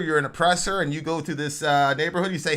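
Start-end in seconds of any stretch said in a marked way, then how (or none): none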